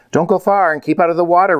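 quick, high cautionary call; reminding. A narrator's reading. Papa reminds the girls before they explore, quick caution. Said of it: "reminded Papa".

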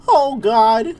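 high, loud cartoon alarm; Goofy. Streamer reacting to the game, Goofy impression. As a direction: exclaim like a dismayed cartoon goofball. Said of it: "I gotta talk like Goofy".

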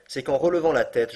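usual speaking voice

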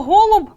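"голуб" punched high and loud on о; stress on о. Shown as a demonstration. Teacher changing the gender to check, "голуб" stressed loudly on о. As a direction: hit the first syllable of "голуб". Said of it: "опинилось під наголосом".

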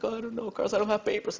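a hint of a crying girl admitting her secret; Monica, tearful friend. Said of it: "she's just crying her heart out".

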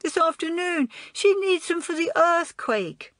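put-on high-pitched little-boy voice; plaintive child, pitched high; upset small boy. A narrator's reading. Small boy explains who took his sweets, upset.

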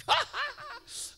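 loud, high cackle; re-enacted stifled-laugh outburst. Re-enacting laughing in his bag during worship, high cackle. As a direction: act out bursting into laughter into a bag. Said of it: "to laugh in my bag".

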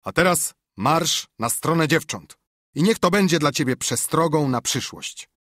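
loud, high stern command; teacher punishing Tom, loud and commanding; teacher's order. Acted. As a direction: send the boy off like a furious schoolmaster. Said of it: "Wreszcie padł rozkaz".

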